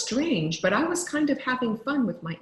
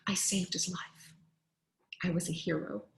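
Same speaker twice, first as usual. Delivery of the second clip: whispered aside; 2 semitones lower, 7 dB quieter than usual. A narrator's reading. Relieved narrator aside.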